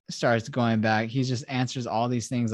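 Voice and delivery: ordinary delivery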